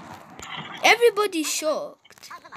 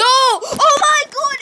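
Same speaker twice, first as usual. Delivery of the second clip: excited shout; 9 semitones higher, 7 dB louder, slower than usual. Real, not acted. Ships approaching during live event.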